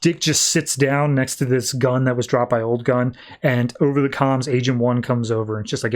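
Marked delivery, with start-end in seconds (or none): none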